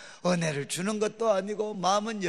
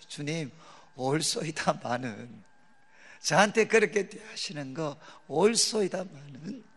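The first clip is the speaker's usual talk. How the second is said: humble prayer; 4 semitones lower than usual. Retold or imitated. Reenacting his own humble prayer.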